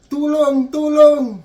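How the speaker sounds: loud, high mock yell; shouting for help. Injured Pinoy pointing and begging for help, hammed-up shouting. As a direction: call out in exaggerated desperation. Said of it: "sigaw siya ng sigaw".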